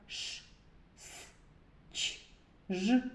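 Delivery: "ш, с, ч, ж" as bare consonant noises. Shown as a demonstration. Teacher showing sounds made of noise, "ш, с, ч, ж" as isolated consonants. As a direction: sound out "ш, с, ч, ж" separately, quiet, no letter names. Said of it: "або тільки шуму".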